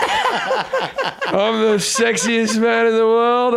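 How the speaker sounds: high, very slow cocky drawl; hammed-up sexiest man boast; mock ecstasy swagger. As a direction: strut it out like a high raver. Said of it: "it would be like".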